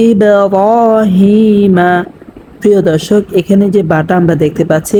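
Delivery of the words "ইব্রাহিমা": ইব্রাহিমা chanted slowly with a bounce on ব; qalqalah recitation. Shown as a demonstration. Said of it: "কলকলা করিয়া পড়তে হবে". (ইব্রাহিমা ধীরে, ব-তে ধাক্কা দিয়ে)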